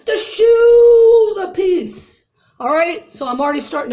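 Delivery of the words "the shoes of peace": drawn-out, loud, much higher call; proclaiming. Real, not acted. Proclaiming God's armor to viewers, slow and loud. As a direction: ring it out like a preacher.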